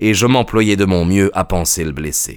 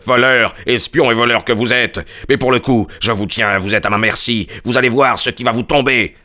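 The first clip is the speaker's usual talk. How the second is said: mild bellowed threat; understated rage, emphatic words; narrated angry yell; 3 semitones higher than usual. A narrator's reading. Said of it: "hurla-t-il". (menace rageuse, jouée avec retenue)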